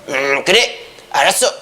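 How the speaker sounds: acted king, low deliberate hum; 헤롯 안디바. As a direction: drop low as if granting a request. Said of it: "헤롯 안디바가". (낮게 음 하고 수긍하는 왕 흉내)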